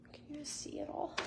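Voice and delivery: hushed unvoiced question; whisper. Real, not acted. Asking viewers if they can see the items, whispered.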